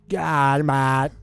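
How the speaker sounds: loud, broad Australian impression; Aussie accent. Showing how the Aussie accent sounds, loud Australian impression. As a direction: exclaim it in a put-on Aussie accent. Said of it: "when you hear the Aussie accent".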